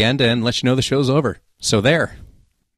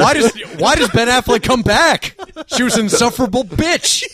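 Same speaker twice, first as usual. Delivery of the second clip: incredulous rant; 13 semitones higher than usual. Real, not acted. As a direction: rant about the movie in disbelief. Ranting about a movie.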